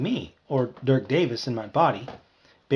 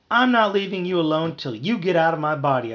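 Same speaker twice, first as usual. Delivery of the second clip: high, loud screech; raised, insistent outburst; 5 semitones higher, 5 dB louder than usual. A narrator's reading. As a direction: screech out an ultimatum. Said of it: "I screeched".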